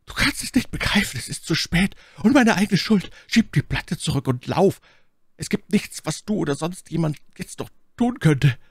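very high, strained cry of despair; desperate scream. A narrator's reading. Warren begging his friend to flee, desperate scream. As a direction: wail it in sheer despair. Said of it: "mit einem Schrei reinster Verzweiflung".

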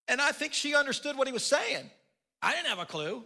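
ordinary delivery

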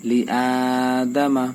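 লি আদামা with the আ after লি drawn out slowly; madd lengthening. Shown as a demonstration. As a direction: stretch only the opening আ of আদামা. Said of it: "এই হামজার উপরে টান". (লি আদামা হামজায় টান)

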